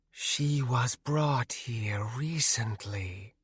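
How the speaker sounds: slow, soft, ghostly question; eerie wraith voice. Acted. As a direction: ask like a drifting spirit. Wraith Nanaya speaks.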